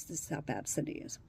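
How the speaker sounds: ordinary delivery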